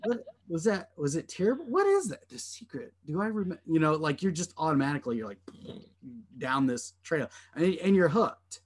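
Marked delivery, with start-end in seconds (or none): 0.0-3.6 high, rising self-questioning; mock frantic puzzling. Brain trying to work out an open loop, mock frantic puzzling. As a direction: act out a brain racing for an answer.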